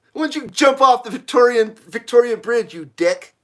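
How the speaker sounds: half-playful heckle, starting high and settling down; mock angry heckling. Australians taunting Paul Hogan, mock angry heckle. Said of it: "They come and go".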